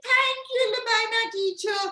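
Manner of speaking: high-pitched grateful kitty; very high pretend-cat voice; cat character. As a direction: thank the vet in a sing-song cat voice. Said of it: "And the catty said".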